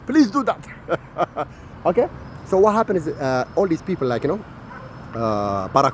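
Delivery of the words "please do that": very slow mocking plea. Ranter begs Europe slowly in a mock pleading voice. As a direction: drag "Please do that." out slowly, pleading.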